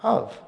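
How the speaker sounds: Of lifted high and held soft; Of said alone, high and quiet; stress on Of. Shown as a demonstration. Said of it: "The emphasis is on the presupposition of".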